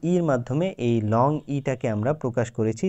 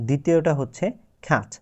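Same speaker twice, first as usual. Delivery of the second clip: ordinary talk, nothing marked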